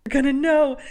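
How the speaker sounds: mock panic. Repeating the panic line.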